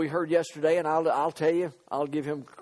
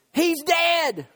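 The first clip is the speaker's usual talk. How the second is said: high-pitched mock crowd shout; shouting and cheering; 11 semitones higher, 5 dB louder than usual. Preacher imitates the crowd at the cross, shouting. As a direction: cry it out like a cheering onlooker. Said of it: "that were shouting and cheering".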